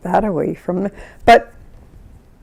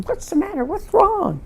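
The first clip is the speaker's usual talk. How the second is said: high, softened urgent questions; retold alarm; 6 dB quieter than usual. Neighbour reaching the upset family, alarmed. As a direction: act out anxious asking, pitched right up.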